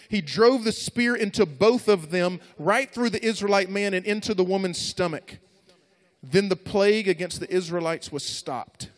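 loud, high, driving scripture reading; impassioned preaching. Acted. Preacher reads the story of Phinehas and the spear, impassioned preaching. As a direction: proclaim the climax with full force.

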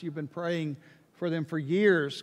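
ordinary delivery

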